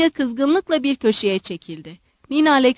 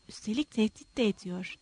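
muttered aside; 13 dB quieter than usual. A narrator's reading. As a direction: mutter it under your breath. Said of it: "diye mırıldandı".

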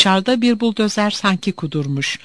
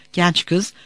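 usual speaking voice

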